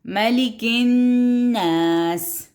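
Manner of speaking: slow chant stressing the doubled n of الناس; slow recitation; recitation with shadda. Shown as a demonstration. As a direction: hold the nn in الناس. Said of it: "پھر شد آ گئی".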